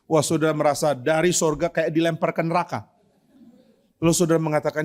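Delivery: usual speaking voice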